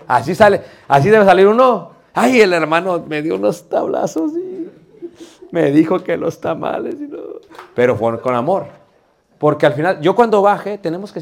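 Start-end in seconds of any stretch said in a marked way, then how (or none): 2.1-7.2 mock whining. Scolded church member complaining about the brother's blows, mock whining. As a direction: wail the complaint, stretching the words. Acts out an aggrieved, scolded listener.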